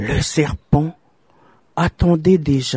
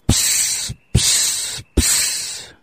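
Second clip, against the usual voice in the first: soft breathy psst hisses from the snake; 4 dB quieter, much slower than usual. Acted. The serpent hissing softly at the young wife. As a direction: hiss each psst like a serpent. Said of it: "siffla le serpent".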